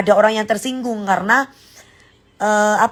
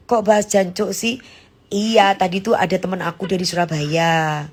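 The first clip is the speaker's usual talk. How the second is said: usual speaking voice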